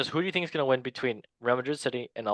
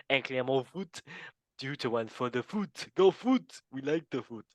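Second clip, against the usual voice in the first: hammed-up French accent. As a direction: call the scores in a put-on French accent. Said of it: "doing the French accent".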